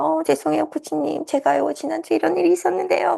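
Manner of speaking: high, sheepish apologizing; apologetic client. Retold or imitated. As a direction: apologize awkwardly to the coach. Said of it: "막 이렇게 얘기를 하실". (민망해하며 사과하는 고객)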